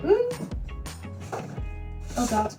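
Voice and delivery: usual speaking voice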